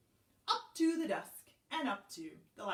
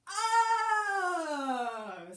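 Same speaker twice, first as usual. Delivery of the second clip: long, loud, high falling "oh"; librarian's realization; 9 semitones higher, 8 dB louder than usual. A narrator's reading. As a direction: draw it out as it dawns on you. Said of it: "said the librarian".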